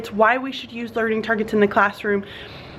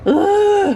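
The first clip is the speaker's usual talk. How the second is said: tired groan; 9 semitones higher, 5 dB louder than usual. Real, not acted. Tired, annoyed by sink.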